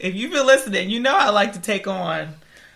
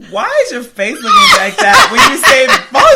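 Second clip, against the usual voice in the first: mock teacher; 9 semitones higher, 11 dB louder, faster than usual. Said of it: "The French teacher at my school was like".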